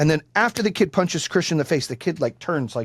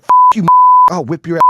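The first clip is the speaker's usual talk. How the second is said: angry dad shout; 11 dB louder, much faster than usual. Retold or imitated. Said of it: "He's like".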